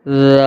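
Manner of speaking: رال with a gentle dh, tongue tip between teeth; soft dh onset, long held vowel in رال; soft ذال. Shown as a demonstration. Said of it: "اس کو ہم نرمی سے پڑھیں گے". (رال نرمی سے، زبان دانتوں کے درمیان)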